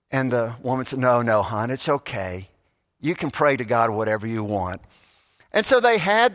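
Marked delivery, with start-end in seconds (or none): 1.0-4.8 gentle reassurance. Retold or imitated. Mother reassures her son.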